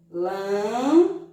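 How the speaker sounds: "Lançamento" in four pieces with long pauses, lan and men held nasal; syllable by syllable. Shown as a demonstration. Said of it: "duas sílabas de som nasal".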